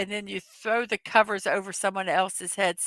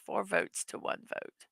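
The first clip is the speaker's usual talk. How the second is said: soft whispered echo of the score; whispered repeat; 13 dB quieter than usual. Real, not acted. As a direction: whisper it as a quiet aside. Repeats result.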